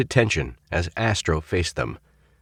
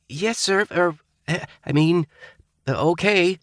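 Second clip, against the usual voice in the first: flustered higher stutter; 12 semitones higher than usual. A narrator's reading. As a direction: stumble over the words. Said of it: "stuttered Coghlan".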